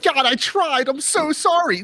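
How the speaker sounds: high, loud, desperate apology; hammed-up wail begging forgiveness; mock pleading. Believer apologising to God at heaven's gates, mock pleading.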